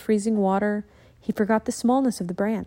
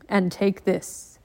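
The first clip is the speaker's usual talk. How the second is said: calm ninja master; slower than usual. Acted. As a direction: speak like a wise old teacher.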